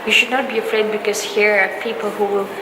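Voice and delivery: ordinary delivery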